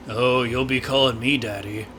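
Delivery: very low, soft teasing voice; mock seductive. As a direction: drop it low and quiet, playfully suggestive. Cheeky retort to game dialogue.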